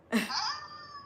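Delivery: brief high-pitched laugh; quick squeaky laugh. Real, not acted. Her own laugh at the cost.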